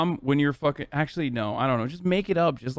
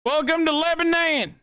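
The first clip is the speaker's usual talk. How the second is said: mock welcome; 11 semitones higher than usual. Mock greeting with local pronunciation.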